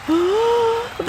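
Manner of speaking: high sharp gasp of amazement; gasp of wonder. A narrator's reading. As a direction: gasp as Santa appears. Santa appears.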